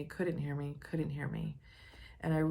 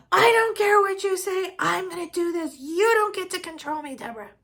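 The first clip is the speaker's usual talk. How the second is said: mother yelling back; 11 dB louder than usual. Retold or imitated. Mother yelling at daughter on phone.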